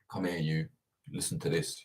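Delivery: quiet, low condescending murmur; mock patronising aside. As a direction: talk down at him softly, a touch of mockery. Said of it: "reporters that kinda be like".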